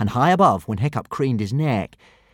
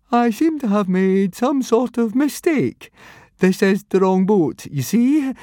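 high, slow, anxious stammer; much higher, fretful and unsure; nervous boy voice; 12 semitones higher, 5 dB louder than usual. A narrator's reading. Nervous Fishlegs caught on ship.

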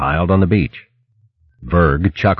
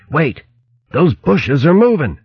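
alarmed warning; 10 semitones higher than usual. Acted. Mate warns of moving bushes.

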